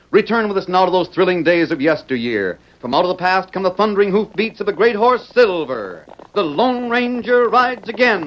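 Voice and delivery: rising, grand announcer build. Acted. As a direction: boom it out like an old radio announcer. Dramatic radio show opener.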